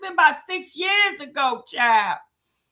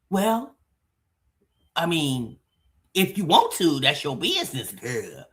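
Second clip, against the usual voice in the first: lower voice; 12 semitones lower than usual. Retold or imitated. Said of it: "Livia answers".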